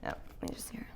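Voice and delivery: breathy aside to yourself; whispering. Real, not acted. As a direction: whisper it while working. Adjuster muttering to himself.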